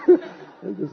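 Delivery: a high, rising whoop of laughter. Real, not acted. As the skeptic sits down defeated, high laugh. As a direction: crack up high at the ending.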